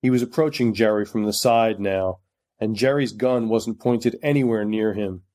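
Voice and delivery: plain reading voice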